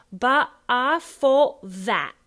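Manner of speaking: loud, slow "fought that" as fough' va'; f in FOUGHT, v in THAT, no final t; Cockney f and v. Shown as a demonstration. Coach modelling the line, Cockney f and v. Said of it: "you have an F and then a V".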